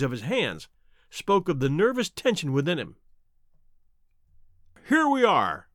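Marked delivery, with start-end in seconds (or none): 4.9-5.7 cheerful, higher-pitched host; cheery. A narrator's reading. Holmes bringing his visitor into the room, cheerily. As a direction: lift it brightly, welcoming a guest.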